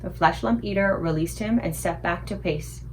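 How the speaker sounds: plain reading voice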